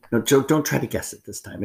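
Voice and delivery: normal talking voice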